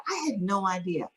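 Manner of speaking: normal talking voice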